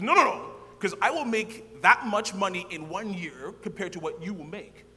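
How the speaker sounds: excited mock dean voice; lively imitation of the pushy dean; lightly voiced dean insisting; retold dean. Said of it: "He goes".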